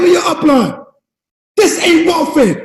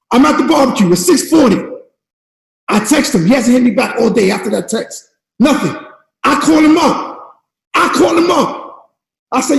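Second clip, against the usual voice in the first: ordinary talk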